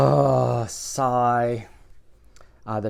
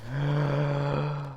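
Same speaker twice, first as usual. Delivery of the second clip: dramatic gasp; 6 dB quieter than usual. Acted. Suspense before reveal.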